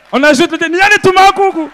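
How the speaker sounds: high loud mocking shout; hammed-up high shout; mock local shout. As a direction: bellow it like a proud local. Imitating Bakongo locals.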